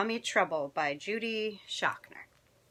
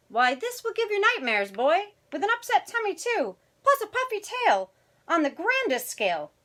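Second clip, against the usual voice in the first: very high, dramatic warning; warning mama; 12 semitones higher, 6 dB louder than usual. Acted. Mama warning her boy of nightmares, high and dramatic. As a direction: play a fretting mother in a high storybook voice.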